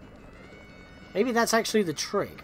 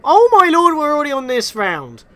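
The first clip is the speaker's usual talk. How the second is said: high, loud amazed outburst; surprised exclaim; 10 semitones higher, 12 dB louder than usual. Real, not acted. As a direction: blurt out in amazement. Surprised at fast progress.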